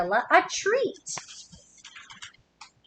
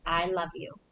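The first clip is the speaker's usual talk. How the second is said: soft, lower i love you; tender i love you; 6 semitones lower, 7 dB quieter than usual. Acted. Reading to kids, the whale saying it tenderly. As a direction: breathe it softly to a loved one.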